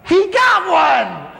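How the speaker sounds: slow, high, loud "He got one!"; excited shout. Retold or imitated. Watching a man walk down the aisle, a high delighted shout. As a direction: cheer like a hunter who scored.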